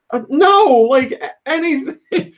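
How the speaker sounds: high, loud incredulous objection; squeaky, flustered pleading; retold refusal. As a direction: protest in mock disgust. Retold refusal of old soap.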